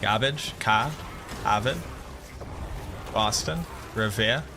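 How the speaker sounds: Boston accent word list; demonstrating broad Boston vowels. Shown as a demonstration. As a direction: show off dropped Boston r's, word by word. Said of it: "the Boston accent".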